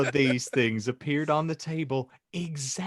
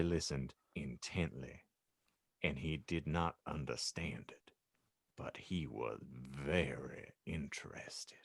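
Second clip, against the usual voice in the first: whispering; 10 semitones lower, 14 dB quieter than usual. A narrator's reading. Narrator whispers how Harry listened to dark talk. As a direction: draw them in with a secret. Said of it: "narration drops to a whisper".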